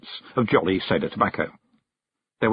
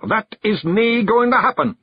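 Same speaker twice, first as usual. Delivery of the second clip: higher, louder Scots outburst; shout; 12 semitones higher, 4 dB louder than usual. A narrator's reading. Rob shouts at Billy so the lad backs away, sudden shout. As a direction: bark it all of a sudden. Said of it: "shouted Rob Anybody".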